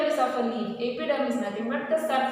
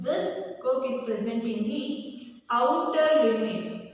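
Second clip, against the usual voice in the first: ordinary delivery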